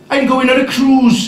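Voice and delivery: chirpy announcement from a mum; a hint of a breezy mother; mother's voice. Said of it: "she said".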